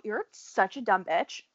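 normal talking voice

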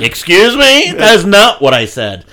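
sharp, loud, high indignant retort. Retold or imitated. Retelling a bar argument, mock offended retort. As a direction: snap back in offended disbelief.

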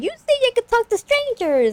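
very high squeaky mock telling-off; cartoonish high-pitched nagging; imitated scolding mom. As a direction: scold in a put-on falsetto. Voicing mom scolding in retelling.

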